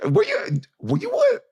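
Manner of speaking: a high, excited squeal; excited to share the gossip. Real, not acted. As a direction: burst out with the news. Sudden excited question.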